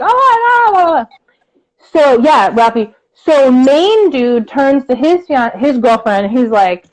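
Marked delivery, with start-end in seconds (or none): 0.0-1.1 high, squealing mock gasp of delight; excited gasp. Imitating the girl at the table squealing with delight. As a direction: imitate her shrieking with joy. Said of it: "she's, like".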